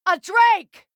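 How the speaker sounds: high, loud cry of alarm; startled shout. Acted. Warrior warning her companion of a charging drake, startled shout. Sudden beast appears in fight.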